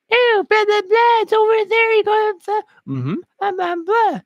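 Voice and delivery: high falsetto mock woman; woman's reply. Woman answering a stranger's question, high mock falsetto. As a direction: squeak out her blah-blah answer in falsetto.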